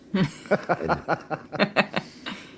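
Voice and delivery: bright rising laugh. Real, not acted. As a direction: laugh high and open at the joke. Laughing at host's joke.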